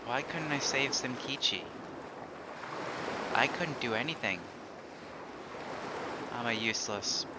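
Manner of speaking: softly read, lightly voiced regretful witch; grieving witch monologue. Reads grieving witch's lines.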